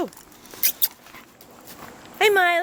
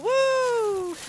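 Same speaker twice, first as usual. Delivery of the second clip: excited whoop; 8 semitones higher than usual. Real, not acted. Cheering the running dog.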